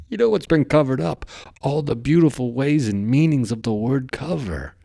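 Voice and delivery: giddy discovery shout; excited outburst. Real, not acted. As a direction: burst out with the big realization. Excited revelation about cover-ups.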